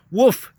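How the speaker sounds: high, loud little woof; mock woof. A narrator's reading. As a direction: bark it like a toddler playing dog. Child mimics wolf bark.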